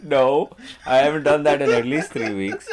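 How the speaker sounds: laughing. Real, not acted. Laughing reply to joke.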